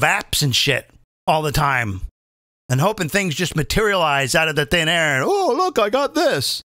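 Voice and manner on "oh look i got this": much higher, smug put-on voice; mock smugness. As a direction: sneer at the know-it-all. Mocking app-using people.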